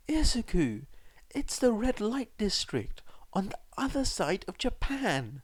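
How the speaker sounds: high, breathy-whispered worried mother; a frightened mum exclaiming in alarm; anxious exclaim. A narrator's reading. Mother alarmed at her son's secret, high and hushed. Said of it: "she exclaimed".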